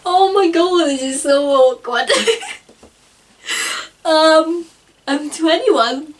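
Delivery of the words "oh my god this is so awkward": normal talking voice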